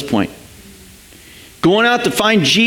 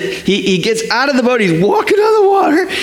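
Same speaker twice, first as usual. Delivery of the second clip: much higher, shouted excitement; high excited shout; 7 semitones higher, faster than usual. Acted. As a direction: burst out with amazement. Excited retelling of Peter's walk.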